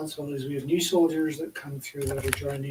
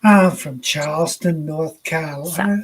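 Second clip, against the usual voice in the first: loud, drawn-out Southern drawl; big slow Carolina drawl; South Carolina accent; 8 dB louder than usual. Retold or imitated. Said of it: "his accent was so pronounced".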